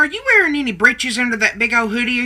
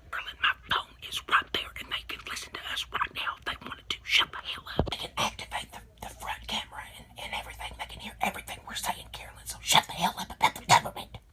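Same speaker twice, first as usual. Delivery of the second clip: much softer, voiceless, urgent whisper; 2 semitones lower, 13 dB quieter, faster than usual. Acted. Nanny Ma warns Carolyn the government can hear, frantic whisper. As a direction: warn her in a frantic hush. Fears phone is listening.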